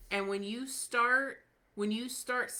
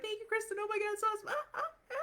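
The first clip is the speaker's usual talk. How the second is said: much higher, squealing thank you ending in giggles; mock gushing fan; 13 semitones higher, much faster than usual. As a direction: ham up an adoring fan bubbling over with thanks. Gushing members in the network.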